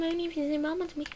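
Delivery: teasing high voice. Pretend.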